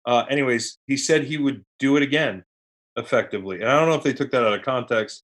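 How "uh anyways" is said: usual speaking voice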